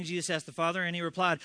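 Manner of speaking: ordinary delivery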